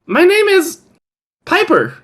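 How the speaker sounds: put-on high-pitched twelve-year-old boy; Piper. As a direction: pitch it up as a small kid. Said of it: "like, twelve".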